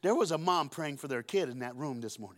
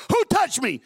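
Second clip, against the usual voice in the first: high, loud cry of who touched me; preacher acting Jesus calling out; shouted Jesus quote; 11 semitones higher, 9 dB louder than usual. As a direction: belt it out as Jesus. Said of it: "Jesus goes".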